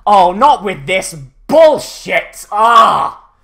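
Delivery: loud, drawn-out, fed-up yell; exasperated yell. Retold or imitated. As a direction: bellow it like a commander out of patience. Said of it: "in that tone of voice".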